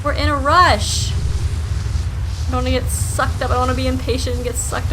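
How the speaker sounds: loud, high frustrated yelling; yelling while running away. Real, not acted. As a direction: yell at the sand pillar while fleeing. Fleeing sand pillar, frustrated.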